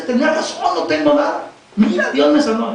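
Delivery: high-pitched, acted excited news; excited announcement. Preacher voices healed daughter telling papá, excited. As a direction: voice a daughter bursting with good news.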